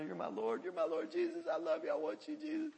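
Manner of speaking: high, soft, tearful outpouring; imitated crying. Freed young man telling Jesus he loves him, crying. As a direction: pour it out in a high, weepy voice. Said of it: "kept crying".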